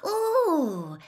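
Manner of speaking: sharp high gasp; a startled high gasp. A narrator's reading. Hermione gasps as Harry leaves. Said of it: "gasped Hermione".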